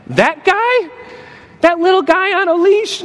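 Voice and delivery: high, scoffing disbelief; mocking disbelief. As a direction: laugh off a puny threat. Mocking the devil as tiny.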